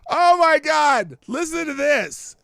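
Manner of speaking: real thrilled exclamation; excited outburst. Real, not acted. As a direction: burst out in delight. Excited reaction to Grok haiku.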